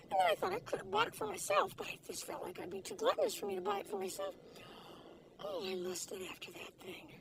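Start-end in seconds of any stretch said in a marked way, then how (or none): none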